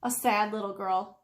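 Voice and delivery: ordinary delivery, nothing marked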